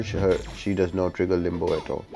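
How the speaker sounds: normal talking voice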